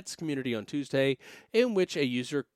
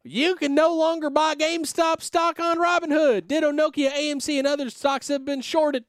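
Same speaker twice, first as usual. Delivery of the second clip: much higher, louder Southern yokel voice; Southern accent; 14 semitones higher, 6 dB louder than usual. Retold or imitated. Host reads a tweet in an exaggerated Southern accent. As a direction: squawk it out like a riled-up Southern trader. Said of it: "making these guys extremely Southern".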